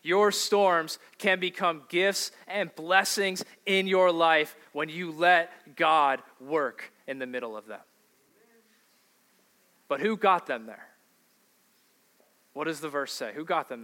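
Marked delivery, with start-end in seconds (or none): none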